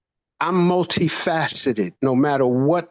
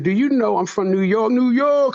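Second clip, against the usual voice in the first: playful New York hype, the second York higher; joking; 7 semitones higher than usual. Retold or imitated. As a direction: hype up your hometown for laughs. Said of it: "'Cause I like to make fun".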